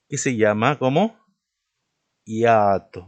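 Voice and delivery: ordinary talk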